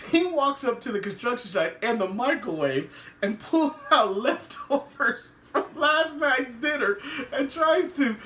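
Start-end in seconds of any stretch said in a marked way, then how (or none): none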